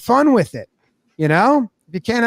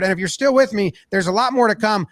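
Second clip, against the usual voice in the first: ordinary talk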